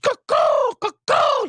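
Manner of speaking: very high mock cuckoo bird call. Birdwatcher imitates the bird over the phone, very high cuckoo. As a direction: chirp it like a silly cuckoo clock. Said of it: "he starts doing the noise over it".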